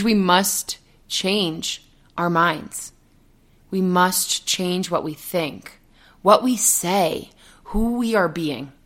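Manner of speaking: slow, deliberate coaching; slow emphatic. Real, not acted. Coach urging listeners to change their minds, slowly. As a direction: spell it out slowly. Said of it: "outside the quote".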